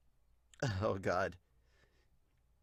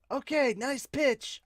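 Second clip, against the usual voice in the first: high, drawn-out player call; high praise call; 11 semitones higher, slower than usual. Acted. Player praising the pitcher on the field, high call. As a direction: pitch it way up and stretch it.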